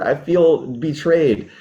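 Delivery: ordinary talk, nothing marked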